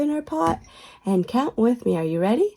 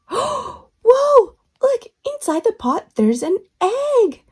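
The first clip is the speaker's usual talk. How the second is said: excited gasp; 11 semitones higher, 4 dB louder, slower than usual. Acted. Kids show host, excited reveal.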